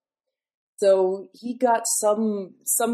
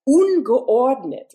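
disorderly; 7 semitones higher, 9 dB louder, much slower than usual. Shown as a demonstration. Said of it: "ungeordnet is like disorderly".

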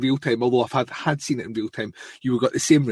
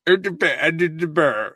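mock impression; 4 semitones higher than usual. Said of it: "it's not even anything like you, but that's what I do".

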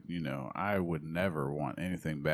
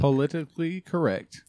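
loud enunciation; 9 semitones higher, 6 dB louder than usual. Shown as a demonstration. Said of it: "You can do, you can do it".

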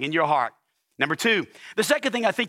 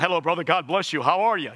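put-on pious pastor greeting; spiritual voice. Pastor greeting a church member, mock spiritual voice. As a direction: greet him with a steeple stuck in your throat. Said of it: "I put my spiritual voice on".

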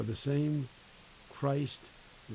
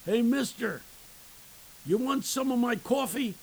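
high, loud street holler; yell; 10 semitones higher, 5 dB louder than usual. Retold or imitated. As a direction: call out like a man yelling to a stranger. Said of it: "and he yells".

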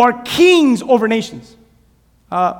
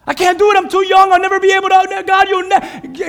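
put-on whining child; 9 semitones higher, 5 dB louder than usual. Jeremiah whines to God he is too young, loud childish whine. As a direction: complain like a stubborn kid.

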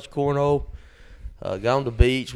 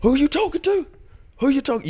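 high-pitched mock confrontation; indignant, much higher retold challenge; fired-up challenge; 14 semitones higher than usual. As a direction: imitate a riled-up friend squaring off. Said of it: "Fires Garner up".

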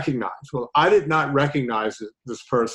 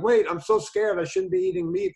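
higher, hammed-up fake fear; mock scared; 8 semitones higher, 3 dB quieter than usual. Host mocks a frightened vegan viewer with hammed-up fear. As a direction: play a frightened viewer for laughs.

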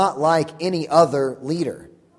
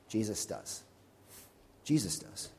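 very soft, low, reverent hush; hushed answer; 7 semitones lower, 16 dB quieter than usual. Real, not acted. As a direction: drop to a near-murmur after the big question. Hushed answer to his own climactic question.

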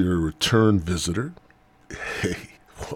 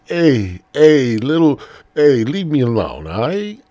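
loud, high, bad Mexican accent; 6 semitones higher, 7 dB louder than usual. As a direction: protest in a hammed-up Mexican accent. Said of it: "Mexican accent".

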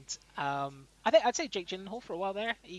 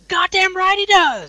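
high, drawn-out movie yell; shouted film quote; 14 dB louder, slower than usual. Acted. Quoting a film line for friends to guess, shouted. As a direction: bellow it slowly, very high.

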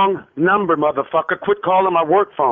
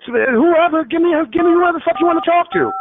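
high-pitched, fed-up outburst; angry and exasperated, pitch jumping high; 11 semitones higher than usual. Real, not acted. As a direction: demand it, voice pitched way up in irritation. Fed-up victim of prank call.

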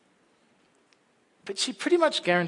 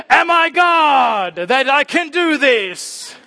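slow, much higher, louder despairing shout; exasperated shout; 11 semitones higher, 10 dB louder, much slower than usual. Retold or imitated. Parent at the end of their tether with impossible demands, shouting slowly. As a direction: cry it out at the end of your rope. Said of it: "I stand there and go".

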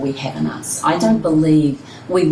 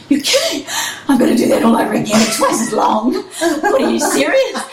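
high, loud, laughing what-are-you-serious; laughing disbelief; 8 semitones higher, 4 dB louder than usual. Retold or imitated. Winner told she must race again, laughing in high disbelief. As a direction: laugh in shock at the news. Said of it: "all I could think was".

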